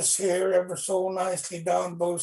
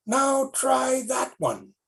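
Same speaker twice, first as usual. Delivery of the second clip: slow, high, taunting dare; older brother goading the little one; challenging big brother; 9 semitones higher, slower than usual. Acted. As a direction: dare him slowly.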